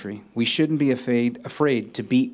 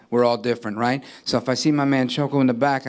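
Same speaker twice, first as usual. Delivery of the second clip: ordinary talk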